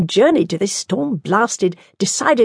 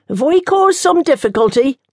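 nun voice; 8 semitones higher, 3 dB louder than usual. Acted.

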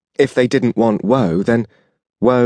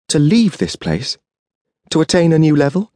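ordinary delivery, nothing marked

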